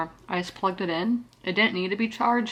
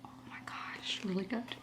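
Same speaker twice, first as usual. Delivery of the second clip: whispered; 12 dB quieter than usual. Retold or imitated. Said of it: "I'm like".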